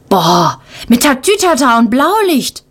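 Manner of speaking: put-on high, wide-eyed child voice; amazed. A narrator's reading. Little boy marvels at the police car, high and amazed. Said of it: "staunt Lukas".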